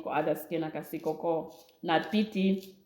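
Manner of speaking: ordinary delivery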